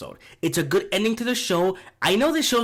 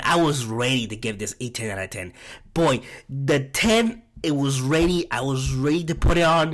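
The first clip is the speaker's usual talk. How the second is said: ordinary talk, nothing marked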